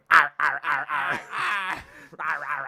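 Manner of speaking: repeated comic "arr" barks; crocodile cry. Acted. Comic showing how crocodiles should talk, wordless croaking barks. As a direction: bark out a wordless crocodile noise. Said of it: "prefer if they're just going".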